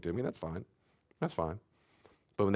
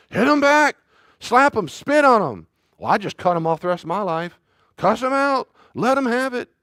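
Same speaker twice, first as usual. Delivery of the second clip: much higher and louder, bellowing each command; hammed-up shouted advice; 13 semitones higher, 11 dB louder than usual. Preacher acting out the world's advice to the congregation, full shout. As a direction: yell it like the world urging revenge.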